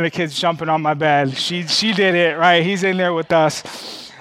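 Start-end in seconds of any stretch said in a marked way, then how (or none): none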